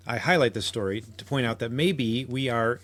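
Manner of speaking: ordinary delivery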